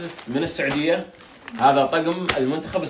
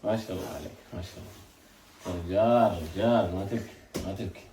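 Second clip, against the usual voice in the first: soft reassurance; 6 semitones lower, 8 dB quieter than usual. Real, not acted. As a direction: ease him gently out of tears. Comforting the crying boy. (مواساة هادئة وحنونة)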